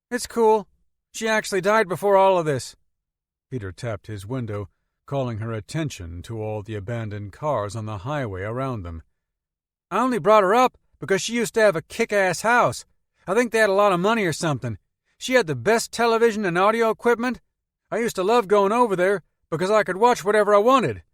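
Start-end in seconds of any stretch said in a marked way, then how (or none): 0.1-2.8 put-on high, lively teenage boy voice; young Peter talking about his grandma. A narrator's reading. 9.9-21.0 put-on high, lively teenage boy voice; young Peter talking about his grandma. A narrator's reading.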